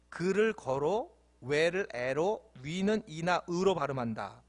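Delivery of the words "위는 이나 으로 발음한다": ordinary talk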